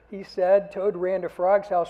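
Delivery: put-on animated children's story reading. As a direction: read the story with big character voices for the kids.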